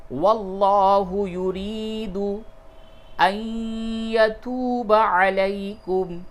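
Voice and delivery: slow, high chanted tajweed recitation; Quran recitation. Shown as a demonstration. Teacher chanting the verse for students, slow and melodic. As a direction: intone the verse slowly and melodically.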